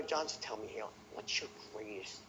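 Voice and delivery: soft, quiet and sincere, like you truly care; sincere tonality. Shown as a demonstration. Salesman asking prospect John his fear, quiet and sincere. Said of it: "Your tonality of sincerity".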